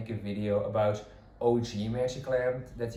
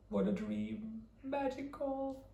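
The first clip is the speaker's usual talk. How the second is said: dreamy falsetto; 13 semitones higher, 8 dB quieter than usual. Real, not acted. Dreamy recollection.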